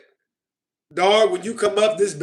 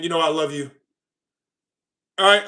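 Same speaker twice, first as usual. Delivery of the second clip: usual speaking voice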